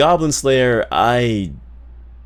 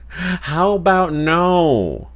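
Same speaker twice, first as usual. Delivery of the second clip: "How about no" stretched very slowly, pitched high; milk every word of "How about no", high and long; hammed-up, slow, high "no" that slides down at the end; drawn-out refusal; 6 semitones higher, much slower than usual. Same refusal redone as exaggerated quote.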